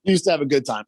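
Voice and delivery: usual speaking voice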